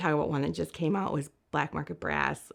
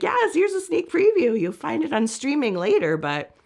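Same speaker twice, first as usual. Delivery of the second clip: excited announcing; 6 semitones higher, 8 dB louder than usual. Retold or imitated. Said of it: "Just like".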